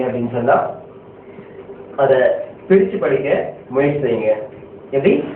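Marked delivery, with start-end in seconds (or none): none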